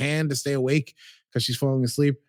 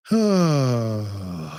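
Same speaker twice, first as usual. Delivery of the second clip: long sigh. Real, not acted. Dramatic sigh.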